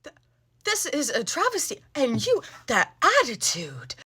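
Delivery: high, soft, scandalized voice; hammed-up affronted complaint; indignant customer. Customer scolding the cashier over balloons, indignant.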